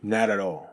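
"Not at all" with both T's turned to soft D flaps. Shown as a demonstration. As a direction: say "Not at all" like "nah-da-dall". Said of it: "実は二回続くんですね".

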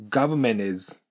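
"government" with the T dropped, like "gabmen". Shown as a demonstration. Teacher showing students the silent T. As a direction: swallow the T in "government". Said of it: "こんな感じですね".

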